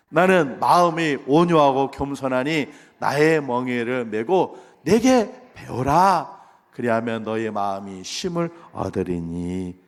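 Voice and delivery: slow, measured verse recitation; solemn drawn-out reading aloud; scripture reading. Real, not acted. Leads a unison scripture reading.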